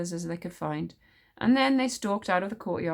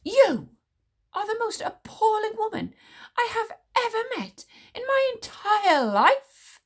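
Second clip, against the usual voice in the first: higher, bitter seething hiss; 4 dB louder than usual. A narrator's reading. Witch smeller bitter at the queen. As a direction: spit it out bitterly. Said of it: "said the witch smeller bitterly".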